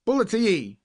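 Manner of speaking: high, loud yell across the boat; shouted order. A narrator's reading. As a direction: yell the command at the boy. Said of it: "shouted the captain".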